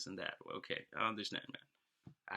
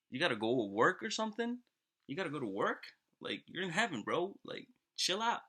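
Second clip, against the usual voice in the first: chiding; 7 semitones higher than usual. Acted. God chides the impatient man.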